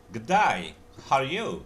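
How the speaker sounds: Australian gedai. Shown as a demonstration. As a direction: sound like an Aussie mate. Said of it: "day-nya mereka jadi kayak ada".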